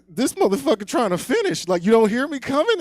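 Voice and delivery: high, loud mock outrage; indignant outburst. Man catches brother not stopping, hammed-up indignation.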